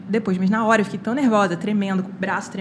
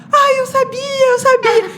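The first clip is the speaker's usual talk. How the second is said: very high, loud imitated squeal, drawn out; overjoyed mom; 7 dB louder, much slower than usual. Daughter imitating mom hearing of the top score, overjoyed. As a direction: squeal it like a mother in triumph.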